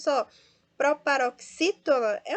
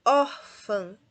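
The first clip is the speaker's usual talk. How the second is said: "Órfã" with a loud first syllable; penultimate stress; 7 dB louder than usual. Shown as a demonstration. Teacher sorts words by stress, "Órfã" loud on ÓR. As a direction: punch the ÓR of "Órfã". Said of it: "Nós temos aqui uma paroxítona".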